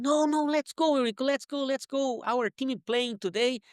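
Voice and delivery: high, eager pleading to come play; friend urging. Retold or imitated. Friend at the door begging him to play soccer, eager high pleading. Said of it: "And he said".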